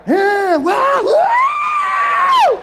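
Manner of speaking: a very high, loud cheer; celebrating the healing with a very high whoop; wild whoop. Retold or imitated. Mock cheer.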